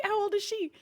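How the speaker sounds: ordinary talk, nothing marked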